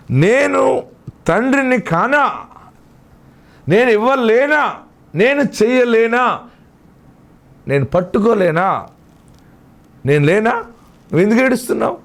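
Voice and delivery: high, ringing rhetorical questions; preacher crying out each question, pausing between; impassioned preaching. Acted. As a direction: challenge the congregation as God the Father, pitch soaring. Preacher voices God's questions.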